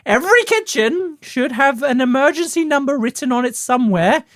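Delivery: high, put-on lecturing falsetto; Julia Child parody. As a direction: instruct viewers like a hammed-up TV cook. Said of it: "pretending basically to be Julia Childs".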